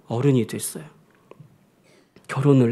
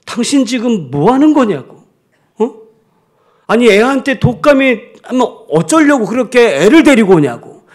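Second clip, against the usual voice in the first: loud, higher scolding of the husband; a retold angry outburst, moderately raised; angry mother snapping; 6 semitones higher, 6 dB louder than usual. Sick mother scolding her husband for bringing their son, mock angry shout. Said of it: "화를 버럭 내는 거야".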